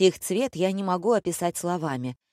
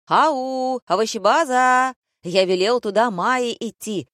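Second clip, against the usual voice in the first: loud, raised-pitch outraged calling out; indignant; 6 semitones higher, 6 dB louder than usual. A narrator's reading. Director calling to the wrong girl, indignant. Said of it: "возмутился режиссёр".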